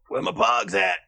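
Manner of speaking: mock rapper's holler. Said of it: "Does he be all".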